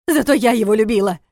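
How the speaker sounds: loud, high furious cry; angry outburst. Acted. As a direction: explode at him in anger. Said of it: "взорвалась Настя".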